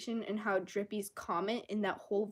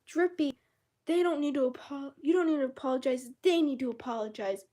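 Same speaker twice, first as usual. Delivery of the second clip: mocking commenter; 5 semitones higher, 6 dB louder than usual.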